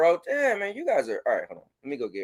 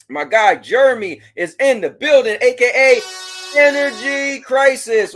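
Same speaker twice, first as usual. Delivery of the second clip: hype announcer; 6 semitones higher, 8 dB louder than usual. Acted. Streamer announcing a chatter like a ring announcer, loud. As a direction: stretch the name out like a boxing intro.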